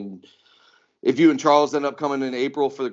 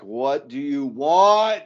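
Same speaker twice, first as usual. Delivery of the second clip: drawn-out, exasperated phone answer; 3 semitones higher, much slower than usual. Retold or imitated. Telling how they answer their brother's call, exasperated. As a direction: stretch each word out in mock exasperation.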